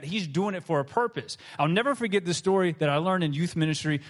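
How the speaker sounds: usual speaking voice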